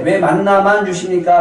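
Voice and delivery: greedy whining. Retold or imitated. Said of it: "탐욕 가운데".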